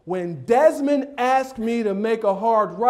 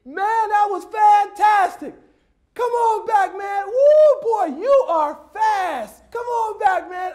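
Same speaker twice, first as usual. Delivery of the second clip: high, drawn-out hollering praise; big excited coach shout, stretched long; yelled out; 13 semitones higher, 6 dB louder, slower than usual. Retold or imitated. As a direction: holler across the field to a running kid. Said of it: "And I yelled out".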